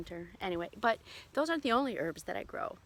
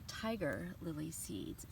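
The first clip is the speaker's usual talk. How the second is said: whispered; 4 semitones lower, 7 dB quieter, slower than usual. Real, not acted. Naming the cherished seeds.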